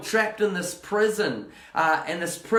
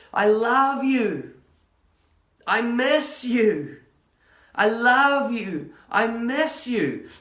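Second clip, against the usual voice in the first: tender voice of God; 4 semitones higher, slower than usual. Acted. God's voice speaking to her.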